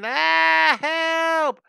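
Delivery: high, loud drawn-out scream; screaming for help. Retold or imitated. Rider towed by a startled dolphin, screaming for help. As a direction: yell for help at full voice. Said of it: "And I was screaming".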